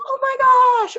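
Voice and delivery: high, loud, drawn-out "oh my gosh"; surprised. Acted. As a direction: exclaim in sudden, high-pitched surprise. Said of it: "either you're surprised".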